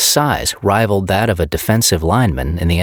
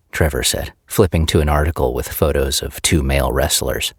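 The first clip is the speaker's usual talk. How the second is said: ordinary delivery, nothing marked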